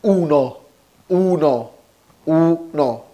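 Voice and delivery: slow clear model of اونو, stressed oo-no; Italian uno. Shown as a demonstration. A teacher models uno for learners, clear and slow. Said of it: "واحد بيتقال اونو".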